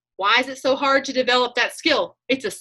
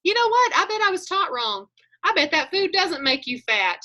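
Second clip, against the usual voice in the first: much higher, a sudden bright idea to yourself; eager inner voice; 7 semitones higher than usual. Retold or imitated. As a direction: talk yourself into it, pitched up high. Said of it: "looking at my food and thinking".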